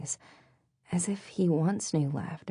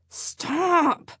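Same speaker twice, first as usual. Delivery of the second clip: tag playful growl; 13 semitones higher, 4 dB louder than usual. A narrator's reading. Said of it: "Maddie growled playfully".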